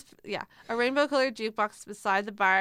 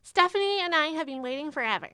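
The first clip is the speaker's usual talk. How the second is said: high whiny voice; 8 semitones higher, 4 dB louder than usual. A narrator's reading. Renee complains in mouse voice.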